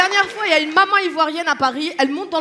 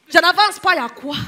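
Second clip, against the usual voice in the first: put-on loud, defiant Ivorian accent; mock-indignant Ivorian retort; Ivorian mama; 3 semitones higher, 5 dB louder than usual. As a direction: dig your heels in like an Ivorian mother. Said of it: "il y a une maman ivoirienne".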